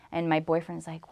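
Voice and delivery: normal talking voice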